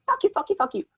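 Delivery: very high, squeaky mock cursing; thin, whispery high-pitched mock abuse. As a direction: imitate rapid-fire swearing in a tiny high voice. Imitating friend cursing the crowd.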